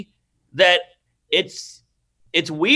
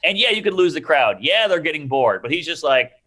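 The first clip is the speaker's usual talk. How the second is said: ordinary talk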